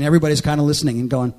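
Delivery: usual speaking voice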